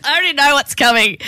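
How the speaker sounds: bright teasing anticipation; eager anticipation. Real, not acted. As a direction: lean in, already knowing the punchline. Listener anticipating the punchline.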